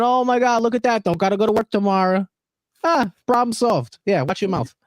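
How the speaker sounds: high New York Italian wiseguy threat; Italian mobster impression. Threatening Brian's kneecap in his Italian mobster impression. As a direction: menace Brian like a mob enforcer, playful. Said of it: "this Italian impression I've been doing".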